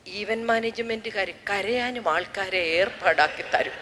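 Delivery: quiet funeral aside to sister; hushed aside. Retold or imitated. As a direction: murmur it so only she hears. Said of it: "സിസ്റ്ററോട് ചോദിച്ചു".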